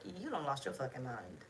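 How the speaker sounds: a hint of a judgmental outsider; judging onlooker. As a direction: mutter it like a stranger judging you. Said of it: "somebody on the outside gonna be looking at you like".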